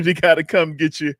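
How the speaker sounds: ordinary talk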